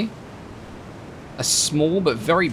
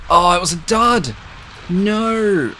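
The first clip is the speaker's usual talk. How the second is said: loud, high wail of frustration; dismayed outcry; 7 semitones higher, 5 dB louder, slower than usual. Real, not acted. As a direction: cry out at a cruel letdown. Torpedo fails, player cries out.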